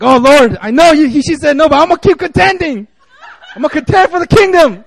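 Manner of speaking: loud, high laughing plea to God; praying hopeful suitor. Retold or imitated. Pastor acting Noble praying for Rosie, loud laughing plea. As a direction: beg God with comic zeal. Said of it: "It's like".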